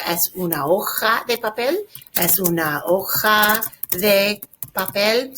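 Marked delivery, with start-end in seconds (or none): 2.1-5.3 slow, separated words for learners; slow model reading. Shown as a demonstration. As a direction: draw out "hoja" and pause before "papel". Teacher repeats it slowly for students.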